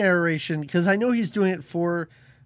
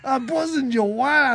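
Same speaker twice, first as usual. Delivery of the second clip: mock creepy limo driver; 5 semitones higher, 3 dB louder than usual. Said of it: "I sound just like that rapey limo driver".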